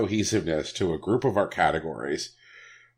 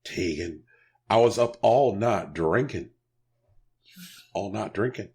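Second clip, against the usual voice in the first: hungover. Acted. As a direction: speak like the morning after a binge. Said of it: "Sorry, I sound a little hungover".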